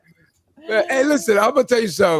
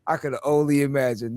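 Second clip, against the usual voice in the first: normal talking voice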